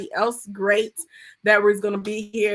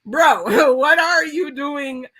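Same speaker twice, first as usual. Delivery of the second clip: louder, higher, slow, laughing through it; laughing mock exasperation at God; laughing exaggerated bro; 4 semitones higher, 5 dB louder, slower than usual. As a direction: ham it up with a laugh, dragging it out. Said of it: "when I say "bro," I am talking about God".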